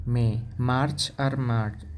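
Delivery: usual speaking voice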